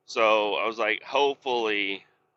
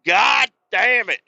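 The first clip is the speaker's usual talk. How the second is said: loud, slow, higher swearing; a fed-up, drawn-out God damn it; frustrated curse; 11 semitones higher, 8 dB louder, slower than usual. Retold or imitated. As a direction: drag out the curse in exasperation. Retold frustrated outburst.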